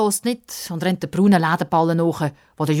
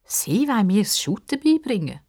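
higher, doubting question; incredulous; 6 semitones higher than usual. A narrator's reading. Girl doubting the old man can teach her, incredulous. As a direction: ask as if you can't believe it. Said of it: "fragt Pippa ungläubig".

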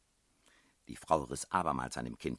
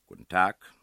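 courteous, louder hello; polite greeting; 5 semitones higher, 13 dB louder than usual. A narrator's reading. Narrator greets the headmistress, with polite emphasis. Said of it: "sagte ich höflich".

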